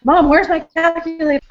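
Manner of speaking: high, annoyed daughter voice; yelling across the room. Retold or imitated. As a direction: call out like a cross teen. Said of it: "she keeps getting mad".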